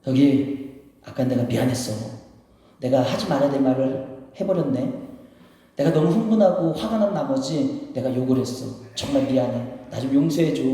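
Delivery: low, subdued acted apology; contrite apology. Preacher playing someone apologizing after a fight, low and contrite. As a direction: ask forgiveness sincerely, voice dropped low.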